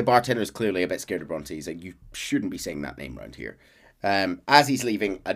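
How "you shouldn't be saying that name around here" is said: low, soft, hushed caution; nervous warning. Retold or imitated. Scared bartender warns Arthur about Bronte, low and hushed. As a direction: warn him under your breath.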